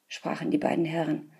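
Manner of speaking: usual speaking voice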